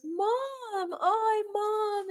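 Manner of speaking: very high, drawn-out syrupy greeting; mock-adoring singsong hello; fake loving greeting. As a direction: imitate a son fawning over his mom. Ex fawns over her mother.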